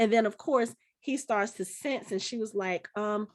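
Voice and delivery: ordinary talk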